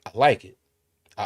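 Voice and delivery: usual speaking voice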